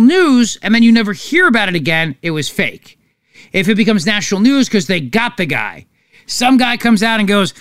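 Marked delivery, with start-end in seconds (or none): none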